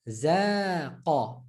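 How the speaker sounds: Razaqa in slow separate syllables. Shown as a demonstration. Teacher breaking down the word before shadda, syllable by syllable. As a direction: pause between syllables of Razaqa. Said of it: "Add the shadda".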